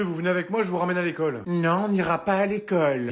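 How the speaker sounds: ordinary talk, nothing marked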